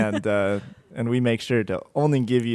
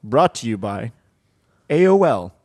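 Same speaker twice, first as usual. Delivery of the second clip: announcer voice; 2 semitones higher, 7 dB louder than usual. Pretend. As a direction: boom it out like a game show host. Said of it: "announcer voice".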